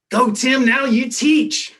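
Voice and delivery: booming, high and loud, slowed right down. Retold or imitated. Professor booming at the new student teacher, commanding and loud. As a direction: boom it down the classroom like a commanding mentor. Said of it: "I hear his booming voice".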